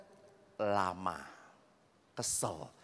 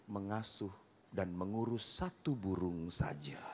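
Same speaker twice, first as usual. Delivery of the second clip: soft, low, unbothered reply; calm him down, totally cool; calm and quiet; 10 semitones lower, 7 dB quieter than usual. Acted. Wife calmly answering her angry husband, soft and low. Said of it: "istrinya dengan tenang ngomong".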